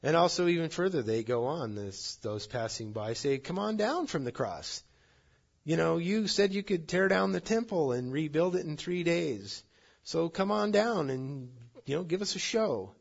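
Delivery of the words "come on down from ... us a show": high-pitched mocking heckle; jeering dare. Passers-by at the cross daring Jesus, high and jeering. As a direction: heckle from the crowd, daring him to perform.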